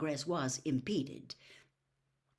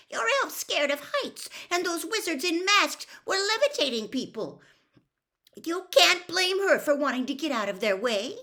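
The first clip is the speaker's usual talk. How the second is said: higher, louder indignant protest; angry protest; 12 semitones higher, 8 dB louder than usual. A narrator's reading. Hermione defending the elf to Mister Crouch, angry protest. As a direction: glare and argue hotly. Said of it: "Hermione burst out angrily".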